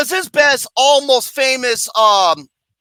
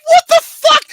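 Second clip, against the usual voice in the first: shocked outburst; 7 dB louder than usual. Real, not acted. Reacting to absurd movie death.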